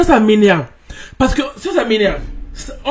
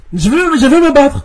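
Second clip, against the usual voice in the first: mock angry; 4 semitones higher, 4 dB louder than usual. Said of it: "Là, je suis énervé".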